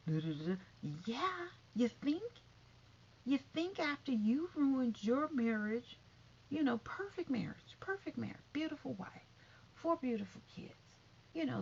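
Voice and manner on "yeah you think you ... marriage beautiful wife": sarcastic retort. Real, not acted.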